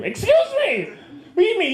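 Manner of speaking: high, indignant disbelief; mock outrage. Comic recoiling at a heavenly cover fee, high and indignant. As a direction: act scandalized at a fee.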